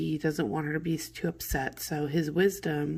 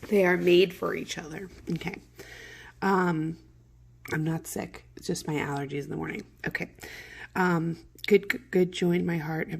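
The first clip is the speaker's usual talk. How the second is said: ordinary talk, nothing marked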